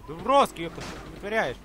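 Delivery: ordinary delivery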